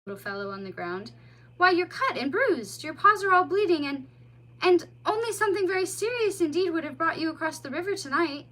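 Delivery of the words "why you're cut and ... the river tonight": higher, louder worried voice; concerned mole. A narrator's reading. Mole to the bleeding otter, concerned. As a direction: fuss over the wounded child.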